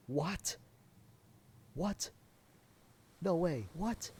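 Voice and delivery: high rising disbelief; can't believe what they're seeing; astonished high What; surprised. Real, not acted. Disbelief at the scene.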